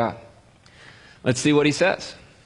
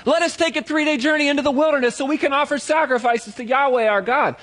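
word for word, pitched high and earnest; reciting the proper words at a higher pitch; backpedaling into the exact script, higher; higher, eager recitation; 11 semitones higher than usual. Shown as a demonstration. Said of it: "here they start to say what Yahweh told them to say".

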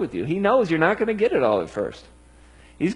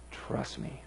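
whispered plea; 6 semitones lower, 15 dB quieter than usual. Acted. Jesus asking his disciples for trust, whispered.

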